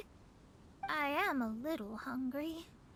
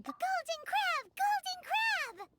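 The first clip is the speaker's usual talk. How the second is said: much higher, slow delighted chant; excited cheer; slower than usual. Acted. Companion cheering the chef's recipe, excited high chant. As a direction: chant it like a hungry kid who just got a treat.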